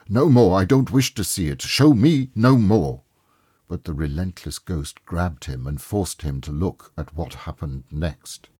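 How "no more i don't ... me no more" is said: loud, high, begging cry; desperate pleading. A narrator's reading. Scrooge begging the ghost to stop, crying out loud. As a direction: plead louder with each line.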